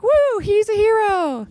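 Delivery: high, slow, laughing cheer; mock cheering crowd. Audience cheering her on, high and laughing. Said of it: "you guys are like".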